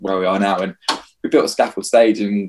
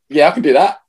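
fake confidence; 6 semitones higher, 4 dB louder than usual. Faking confidence to licensing head.

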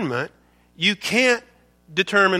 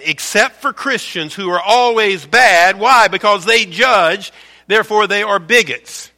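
emphatic preaching; 2 semitones higher, 7 dB louder than usual. Real, not acted. Preacher rousing the congregation against liberal judgment, loud and emphatic. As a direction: preach it hard, driving the point home.

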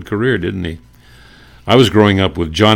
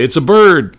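much higher, louder eager cry; radio bystander crying out, higher and louder; excited bystander; 9 semitones higher, 8 dB louder than usual. Retold or imitated. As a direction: spot it and shout. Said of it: "voice number one would say".

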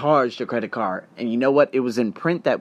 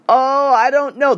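unsure hedging; 10 semitones higher, 6 dB louder, slower than usual. Shown as a demonstration. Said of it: "the moment you say".

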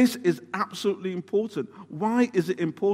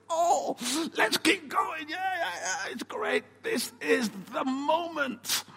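high, eager cheering; carried-away Peter; 9 semitones higher, 4 dB quieter, slower than usual. Acted. Peter urging the others on, high and eager. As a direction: hype everyone up to keep going. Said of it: "So he says".

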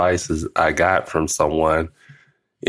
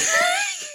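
squealed high laugh; high squealing laugh. Real, not acted. Granddad laughing at his own story, high squeal. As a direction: let a high laugh burst out.

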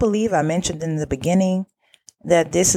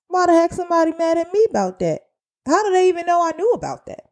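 high, rapid incredulous questioning; indignant disbelief; 11 semitones higher, faster than usual. Retold or imitated. Worker thinking aloud about unfair anger, indignant disbelief. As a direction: race through it, pitched high in disbelief. Voices own indignant thought.

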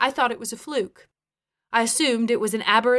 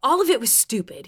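high, frustrated retort; exasperated, louder admission; 6 semitones higher, 4 dB louder than usual. A narrator's reading. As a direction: shoot back in frustration. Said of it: "he shot back with frustration".

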